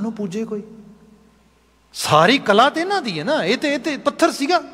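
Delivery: high-pitched mocking protest; acted scornful insistence, pitched well up; taunting. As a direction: scoff insistently as the sceptical craftsman. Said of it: "ਟੌਂਟਿੰਗ ਹੋ ਰਹੀ ਸੀ".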